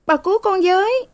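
put-on high, pleading young maid. A narrator's reading. Frightened maid begs her mistress to save her, high and pleading. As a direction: beg the mistress for help in a high voice.

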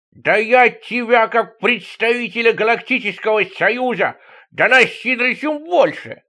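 very high, loud put-on voice; high-pitched comic tantrum; squealing diver in a rage. A narrator's reading. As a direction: squeal the threat, outraged. The squealing diver explodes at the ferret.

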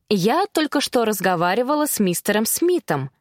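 high, proud announcement. A narrator's reading. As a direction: boast as if meeting the king. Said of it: "сообщила она так гордо".

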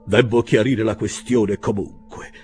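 resolute declaration. A narrator's reading. Pickwick resolves to settle the matter, loudly decisive. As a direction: declare it as if thumping the table. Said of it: "battendo la mano sulla tavola".

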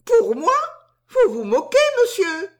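voix très aiguë et forte, incrédule; surprise, presque un cri; soubrette étonnée. A narrator's reading. Susan surprise. (Susan n'en croit pas ses oreilles, voix aiguë)

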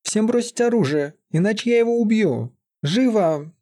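high-pitched commanding threat; tense hostage-taker's demand; urgent threat. A narrator's reading. Heroine threatens at sword point.

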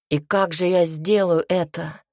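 a touch of bitter, scoffing disbelief; bitter irony. A narrator's reading. Prisoner doubts he can sway his beloved, bitter irony. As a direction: ask it with a wry, bitter edge. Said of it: "спросил Альберт с горькой усмешкой".

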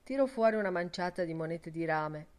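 plain reading voice

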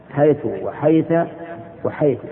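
slow, careful demo of حيث وحيث وحيث, each vowel set apart; three dialect variants of haith. Shown as a demonstration. Teacher showing students the three dialect forms, slow and deliberate. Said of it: "ففيها لغات ثلاث".

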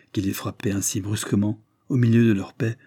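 usual speaking voice

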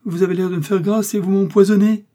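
high, strained accusation; agonised, high-pitched reproach; dying man's outcry. A narrator's reading. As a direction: cry out as a poisoned man accusing his killer. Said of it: "s'écria-t-il en apercevant D'Artagnan".

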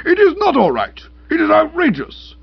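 high, loud pompous indignation; outraged rebuke. A narrator's reading. Uncle warning the officer to be careful, outraged. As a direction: lay down the law indignantly.

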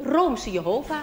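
usual speaking voice

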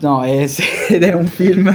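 laughing through the words. Real, not acted. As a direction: giggle over the slip. Laughing at own slip.

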